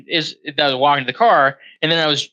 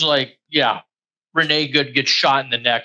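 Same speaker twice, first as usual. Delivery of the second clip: usual speaking voice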